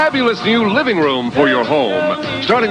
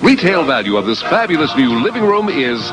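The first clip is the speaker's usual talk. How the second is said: booming prize announcer; 11 semitones lower than usual. Acted. Announcer reads prize package.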